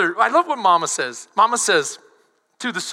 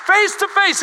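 emphatic repeat; 9 semitones higher, 4 dB louder than usual. Acted. Preacher repeats with force.

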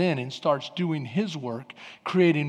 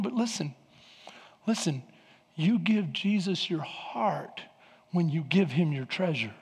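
much softer, intimate plea; quiet; 8 dB quieter than usual. Acted. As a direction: drop right down and draw them in. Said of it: "dropping to soft voice".

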